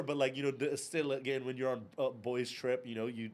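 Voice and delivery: ordinary talk, nothing marked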